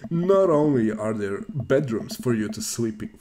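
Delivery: slow, drawn-out sales pitch voice; cheesy announcer. Acted. Dad pitches the new house, cheesy announcer voice. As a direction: stretch it out like a hammy announcer. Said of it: "do my best cheesy announcer voice".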